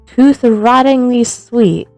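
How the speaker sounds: usual speaking voice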